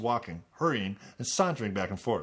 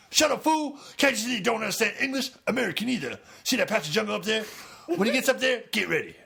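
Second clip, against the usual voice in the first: much higher, loud tough-guy bark; 10 semitones higher, 4 dB louder than usual. Acted. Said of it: "So that's B A".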